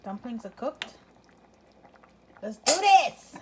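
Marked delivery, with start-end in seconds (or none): 2.4-3.4 excited. Real, not acted. Excited start to eating.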